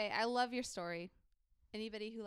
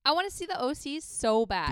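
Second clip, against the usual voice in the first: loud, pitched-up eager outburst; real longing excitement; 4 semitones higher, 10 dB louder than usual. Real, not acted. Hearing the band's show sold out, loud eager longing. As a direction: burst out with how much you want it.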